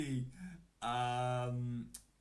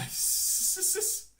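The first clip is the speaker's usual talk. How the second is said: wheezing laugh; 4 dB louder than usual. Real, not acted.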